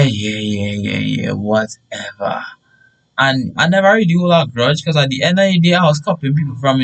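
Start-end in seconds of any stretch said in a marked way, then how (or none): 0.0-2.4 low, sulky brush-off; grudging whatever, stretched at the end; dismissive. Retold or imitated. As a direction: brush off the lecture, trailing away low. Acted dismissive reply to teachers.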